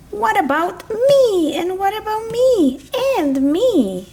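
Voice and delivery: high little voice begging for food; high pleading animal. Acted. Hungry toy animals asking for food, high pleading.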